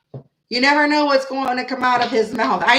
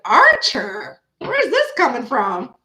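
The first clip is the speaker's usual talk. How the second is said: a much higher, louder surprised call; mock shocked; 4 semitones higher, 4 dB louder, slower than usual. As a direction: call him out, playfully shocked. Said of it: "'cause I was like".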